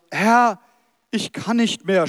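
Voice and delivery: mildly weary groan, read aloud; a touch of exhausted pleading; erschöpftes Stöhnen, angedeutet. Acted. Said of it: "stöhnte er". (leicht gestöhnt, erschöpft)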